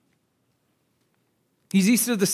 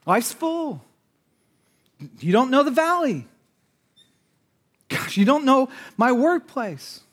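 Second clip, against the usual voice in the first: higher-pitched mock self-justification; defensive excuses; 6 semitones higher than usual. Congregant justifying himself to the preacher, defensive. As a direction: make excuses in a raised, protesting pitch.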